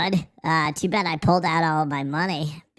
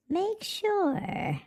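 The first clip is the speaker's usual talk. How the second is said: squeaky high repeat; 6 dB quieter than usual. Shown as a demonstration. Warning chat not to steal, in a high squeak. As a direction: jump way up high on make sure.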